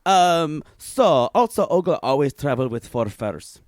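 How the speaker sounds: broken-English Olga, deadpan and boastful; Olga character. Acted. A made-up Olga telling the hosts about her trip, deadpan and broken. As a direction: play Olga as a blunt foreign traveler.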